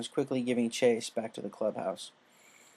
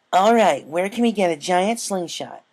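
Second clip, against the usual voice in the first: eager filly; 7 semitones higher, 8 dB louder than usual. Acted. Scootaloo voiced, eager.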